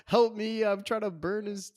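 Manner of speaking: high, pleading imitation; mock heartfelt plea. John begging the priest to help him, mock heartfelt. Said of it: "playing to his heart's like".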